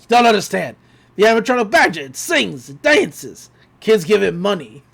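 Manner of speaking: Stan pitching. Retold or imitated. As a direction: sell the badger with mock insistence. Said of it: "Stan is trying to tell Wendy all about this".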